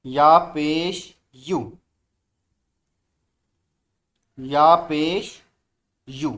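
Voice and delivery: slow, drawn-out letter drill; slow recitation. Shown as a demonstration. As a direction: model each syllable slowly. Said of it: "बुलंद आवाज़ से".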